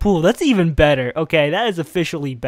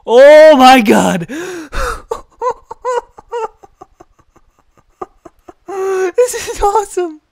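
screaming with laughter; loud, thrilled, laughing; excited shouting; 14 semitones higher, 10 dB louder, slower than usual. Real, not acted. Tube hits the other slide.

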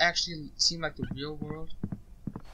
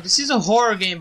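higher and louder, wide-eyed announcement; excited; 6 semitones higher, 9 dB louder than usual. Real, not acted. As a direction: exclaim it with nervous energy. Exclaims to viewers.

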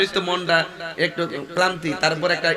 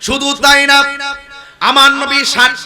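loud, high chanted sermon delivery; full-force preaching; 9 semitones higher, 9 dB louder, slower than usual. Real, not acted. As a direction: proclaim the story to a crowd. (উঁচু গলায় সুরেলা ওয়াজ)

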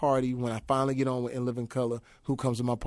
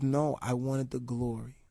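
soft imitation; 3 semitones lower, 9 dB quieter, slower than usual. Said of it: "He says".

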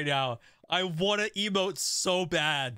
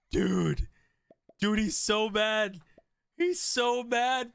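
ordinary talk, nothing marked

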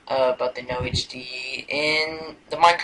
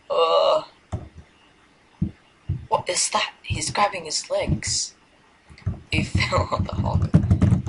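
usual speaking voice

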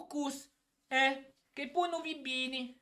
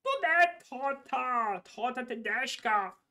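put-on German accent. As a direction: bark orders in German accent. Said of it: "adesso inizia a fare pure tedesco".

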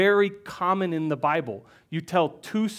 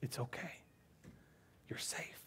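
softer, breathy whisper of comfort; gentle whisper; 24 dB quieter than usual. Acted. God soothing a scared child inside the walls, whispered. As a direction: hush a frightened pup to safety. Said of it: "he's also inside the walls saying".

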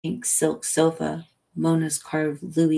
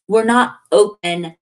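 raised, louder call; shout through glass; 4 semitones higher, 9 dB louder than usual. A narrator's reading. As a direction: shout it to someone behind a glass door. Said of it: "Mona shouts to the glass".

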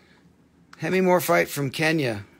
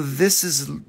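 usual speaking voice

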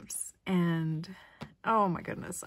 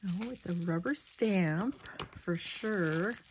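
usual speaking voice

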